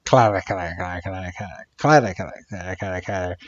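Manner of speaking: low, soft, clipped alien clatter; a muttered, deep-pitched alien noise; alien chatter. Retold or imitated. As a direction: rattle it off like a gray space alien. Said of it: "these aliens are going, they're like".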